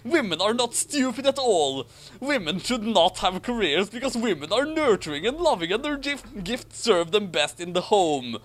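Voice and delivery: lightly voiced righteous Harry; earnest Harry voice. A narrator's reading. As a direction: read it like a self-righteous hero. S0 voices Harry reading the fic.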